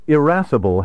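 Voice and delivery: irascible begun with "ih", as in "it"; short initial I. Shown as a demonstration. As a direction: say irascible as ih-RASS-ible. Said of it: "pronounced with a short initial I".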